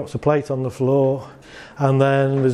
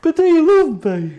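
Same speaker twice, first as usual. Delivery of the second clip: high, louder, laughing plea; insistent mock-needy nag, pitched way up; needy pleading; 13 semitones higher, 5 dB louder than usual. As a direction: whine it up high, half laughing. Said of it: "you go".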